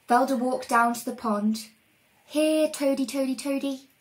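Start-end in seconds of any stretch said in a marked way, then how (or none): 2.3-3.9 mild sing-song call to toads; coaxing call. Acted. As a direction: lightly lure the toads over. Coaxing toads to come closer.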